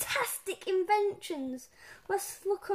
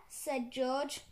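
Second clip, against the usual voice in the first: ordinary delivery, nothing marked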